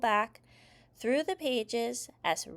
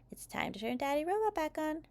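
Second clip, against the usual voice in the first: ordinary delivery, nothing marked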